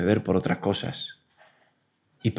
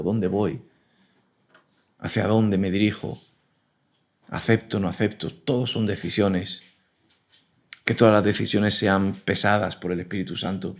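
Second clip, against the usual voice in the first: ordinary talk, nothing marked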